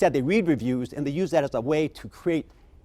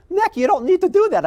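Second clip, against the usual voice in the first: much higher and louder, delighted disbelief; surprised protest; 6 dB louder than usual. Retold or imitated. Guest to the bartender refusing the free scotch, a high loud amazed protest. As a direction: protest the gift, genuinely floored. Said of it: "I'm going".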